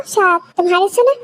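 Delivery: ordinary talk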